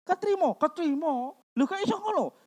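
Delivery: high, light astonished voice; mock amazement. Believer amazed at being accepted, mock astonishment. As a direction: imitate amazement, pitched high.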